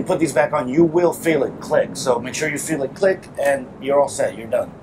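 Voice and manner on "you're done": ordinary talk, nothing marked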